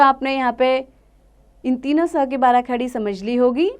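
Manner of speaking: usual speaking voice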